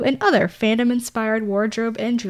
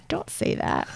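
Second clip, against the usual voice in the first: whisper; 6 semitones higher, 6 dB quieter than usual. Real, not acted. Unvoiced reply.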